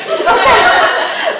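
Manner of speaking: high, loud burst of laughter; real breathless laugh at herself. Real, not acted. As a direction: crack up loudly. Laughs at quoting her own name.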